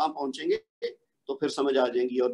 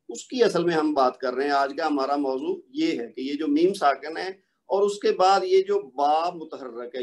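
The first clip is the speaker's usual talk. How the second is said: ordinary talk, nothing marked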